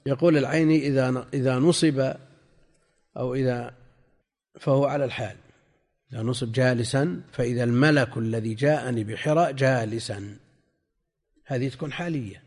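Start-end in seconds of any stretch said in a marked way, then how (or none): none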